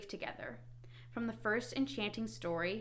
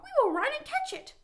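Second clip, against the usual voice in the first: very high, fast and loud; eager, quick storybook squeal; urgent Frog; 6 dB louder, faster than usual. A narrator's reading. Frog urging Toad in story.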